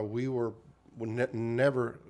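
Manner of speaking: ordinary talk, nothing marked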